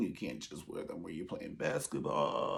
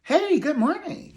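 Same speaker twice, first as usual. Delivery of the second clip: much higher, louder "hey, good morning" with a rising sing-song; mock chirpy greeting; 10 dB louder, slower than usual. Shown as a demonstration. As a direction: squeal "hey" way up and sing the rest.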